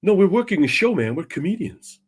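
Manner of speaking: Ronnie Edwards. Retold or imitated. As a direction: imitate a friend amiably explaining himself. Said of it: "Ronnie's like".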